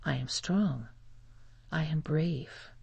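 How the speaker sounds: ordinary delivery, nothing marked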